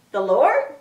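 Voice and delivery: questions rising steeply at the end; mock disbelief. Jack learning it is the Lord, mock disbelief. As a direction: check it with acted astonishment. Jack reacts to hearing it's God.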